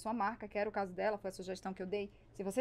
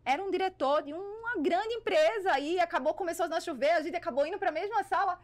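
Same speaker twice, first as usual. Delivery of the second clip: higher, livelier storytelling; animated storytelling; 8 semitones higher, 4 dB louder than usual. Real, not acted. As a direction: build to the punchline, pitched up. Animated climax of her anecdote.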